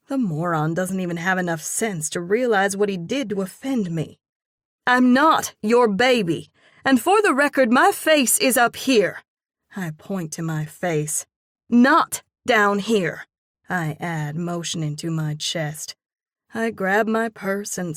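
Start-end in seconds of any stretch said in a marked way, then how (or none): 4.9-9.2 loud, high, furious retort; angry. Acted. Angry woman tells off date. 11.7-13.3 slow, loud and pointed; angry. Acted. Angry woman points at her chest.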